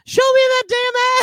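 very high, loud holler; mock yell. Co-host repeats the cheeky caption, high loud mock yell. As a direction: squeal it out at full volume.

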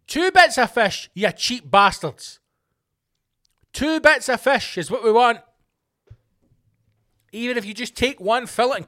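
ranting vlogger yelling a demand at chip shop owners; shouting demand. Real, not acted.

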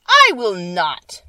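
loud, slow, defiant refusal; witch's retort. Acted. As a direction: snap back like a wicked witch. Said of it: "retorted the witch".

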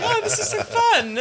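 high, laughing delight; mock thrilled customer, giggling. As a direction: squeal it through a laugh. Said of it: "And you're like".

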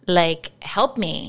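normal talking voice

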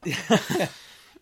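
loud high burst of laughter; a sudden loud real laugh. Real, not acted. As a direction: crack up at the joke. Laughing at friend's ghost joke.

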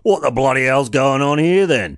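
loud mock Aussie bloke; aussie tradesman. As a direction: bellow it like an outraged bloke. Voicing the swimmer.

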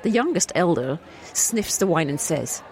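normal talking voice

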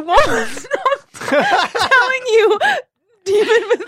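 laughing hard. Real, not acted. Co-host cracking up at a guy endlessly telling demon stories, laughing hard. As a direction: barely get the words out through laughter. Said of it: "I'm, like, laughing so hard".

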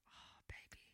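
hushed, whispered sympathy; breath-only, acted pity. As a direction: whisper it like a soft aside of pity. Acts out pity for pick-me.

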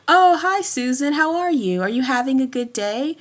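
high, bright-toned polite office greeting; code-switched work voice. Retold or imitated.

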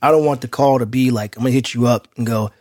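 ordinary talk

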